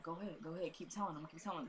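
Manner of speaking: very soft, low-volume urging of a sick man; imitated weak urging. Sick father urging the woman to keep telling, very hushed.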